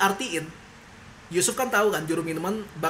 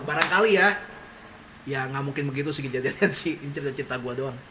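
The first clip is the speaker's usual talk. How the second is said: ordinary delivery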